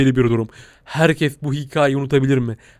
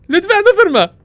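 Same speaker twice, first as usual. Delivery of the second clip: very high, loud begging; hammed-up shrill plea; mock pleading squeal; 5 dB louder, slower than usual. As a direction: plead with the monster not to bite. Begging monster not to bite.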